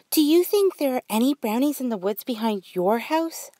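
put-on high, eager little girl; a child asking hopefully; curious girl voice. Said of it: "asked Brooke".

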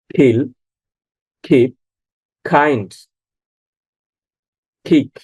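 খিল, খিপ, কাইন্ড, কিক each opening on aspirated "kh". Shown as a demonstration. Teacher demonstrates example words, K as খ. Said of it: "সবগুলোতে খ এর মতো".

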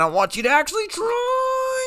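high-pitched yelled challenge; taunting shout. Real, not acted. Brother goads his rival to try in the arm wrestle, taunting shout. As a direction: shout it high, stretching the last word.

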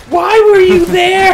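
very high, loud, slow disbelieving cry; mock outraged viewer yelling at the screen; incredulous yell. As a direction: shout it in comic disbelief. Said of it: "I'm just sitting there going".

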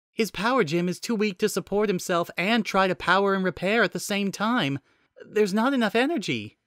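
high-pitched AI assistant reporting a problem; Nicole the AI, concerned report. Acted.

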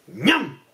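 swooping cartoon gulp; sudden "ñam" with pitch leaping up and down; chomp noise. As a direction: imitate a crocodile gobbling something up. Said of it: "llegou un son. Así".

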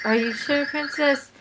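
not her voice. Acted. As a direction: ask it nearer your own pitch, in character. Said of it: "Oh, that's her voice".

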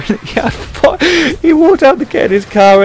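high, breaking up with laughter mid-sentence; can barely get the words out for laughing; real giggling through the words. Real, not acted. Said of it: "I can't tell this joke without laughing".

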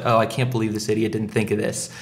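usual speaking voice

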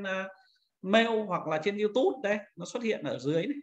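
ordinary talk, nothing marked

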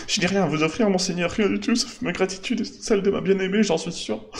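high, imploring villager voice; desperate villager. Acted. Villager admits he has no reward to offer, pleading. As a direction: plead from a position of total poverty.

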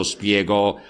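ordinary delivery, nothing marked

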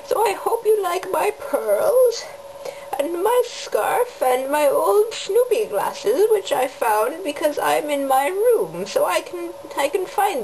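high, slow scolding grandma; old grandma voice. Pretend. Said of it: "I'm being an old grandma now".

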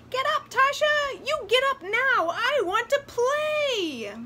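high, loud, demanding doll voice; bossy doll. A narrator's reading. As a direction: order her up like a spoiled little toy. Bossy doll orders tired girl.

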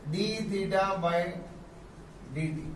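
very slow, each part drawn out; teacher spelling out d theta by d t; slow spelled-out reading. Shown as a demonstration. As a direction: dictate it slowly. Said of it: "ये ऐसे पढ़ेंगे".

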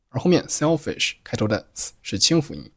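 normal talking voice

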